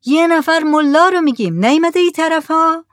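high, loud shouted question; calling from the boat. A narrator's reading. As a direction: holler it over to the shore. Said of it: "از روی جهاز گفتند". (با صدای بلند از روی جهاز)

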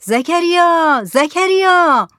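high, drawn-out calling of a name; shout. A narrator's reading. Headman hailing the fisherman at sea, drawn-out shout. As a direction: holler it long and loud to carry far. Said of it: "کت خدا دوباره داد زد".

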